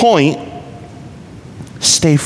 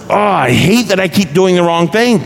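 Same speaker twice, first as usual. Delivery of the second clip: frustrated cry; 5 semitones higher than usual. Retold or imitated. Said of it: "in our own words".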